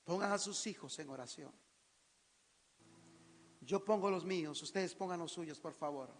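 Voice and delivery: soft, low voice sinking at phrase ends; subdued pastoral request; hushed call to prayer. Real, not acted. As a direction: quietly invite them to pray. Softly leads congregation into prayer.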